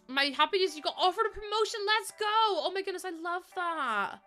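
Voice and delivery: hyped congrats to a viewer; excited congratulations. Real, not acted. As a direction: squeal it like great news. Cheering chat news.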